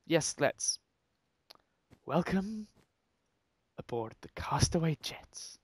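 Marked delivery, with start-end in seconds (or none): 2.1-5.6 flight attendant. Acted. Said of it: "Let's read it like a, uh, like an air hostess".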